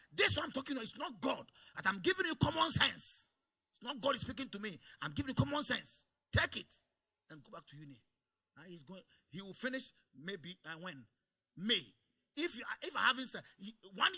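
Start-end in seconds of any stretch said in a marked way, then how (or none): 0.1-8.0 raised, higher pastoral insistence; firm forceful advice. Retold or imitated. Pastor retelling advice to his son, loud and firm. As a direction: drive the point home hard. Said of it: "I told him".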